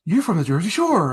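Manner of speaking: a hint of the Fink's booming delivery; mild Fink impression; Howard Finkel voice. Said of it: "he's got that Fink voice".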